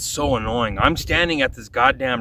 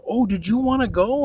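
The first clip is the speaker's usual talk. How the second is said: mock-sweet family; 11 semitones higher than usual. Mimics the family's sweet reply.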